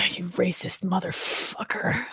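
hushed mock-offended whisper; playful whispered jab at a friend's joke. Real, not acted. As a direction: breathe it out under your breath. Mock insult after accent joke.

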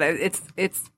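quiet, fighting back tears; holding back tears. Real, not acted. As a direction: voice catching, trying not to cry. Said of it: "I'm trying not to cry right now".

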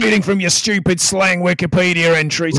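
loud mock-scolding in Aussie; hammed-up Australian jeering; Australian accent. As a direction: heckle in a broad Australian accent. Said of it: "needs her Australian accent".

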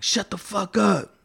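high-pitched imitation of annoyed inmates; retold angry order. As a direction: act out bullies silencing a crybaby. Big guys silencing crying kid.

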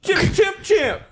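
high, sharp mock scolding; mock-angry pet name. Boyfriend scolding with a silly pet name, high mock anger.

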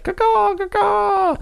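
high screeching eagle caw; shrill screeching bird. Pretend. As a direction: shriek it like a circling eagle. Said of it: "The spoil eagle is flying around".

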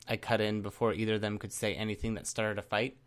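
usual speaking voice

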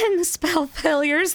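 southern accent slipping out, pitched high. Real, not acted. Host listing show topics, southern accent coming out. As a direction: let a southern drawl creep into the words. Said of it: "There's my southern accent coming out".

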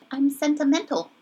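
cute, funny delivery. Retold or imitated. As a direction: imitate her funny little way of talking. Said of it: "she says it really funny".

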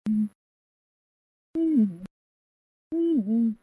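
high dismissive mm-hmm; mock hum. Preacher mimicking careless worshippers, high dismissive hum. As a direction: hum like you can't be bothered. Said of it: "they have the right to go".

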